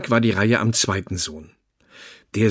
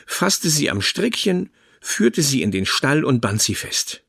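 ordinary delivery

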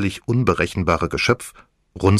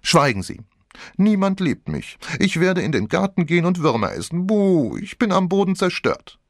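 high, hammed-up childish wail; whiny mock-tragic little girl; mock despair; 11 semitones higher than usual. A narrator's reading. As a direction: play the sulky child for laughs.

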